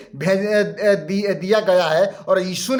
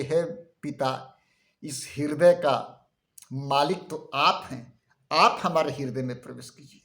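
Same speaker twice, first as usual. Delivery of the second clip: humble prayer; 5 semitones lower, 5 dB quieter than usual. Acted. King David praying to God.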